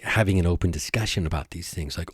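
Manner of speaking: ordinary delivery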